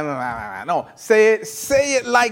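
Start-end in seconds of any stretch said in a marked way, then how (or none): 0.0-0.6 low, soft mock mumble; half-hearted mumble. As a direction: imitate someone reciting without meaning it. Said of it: "Don't be like".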